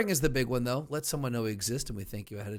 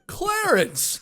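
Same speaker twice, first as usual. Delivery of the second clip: high, loud joyful shout; excited shout; 9 dB louder than usual. Retold or imitated. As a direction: call out the name with giddy joy. Quoting It's a Wonderful Life.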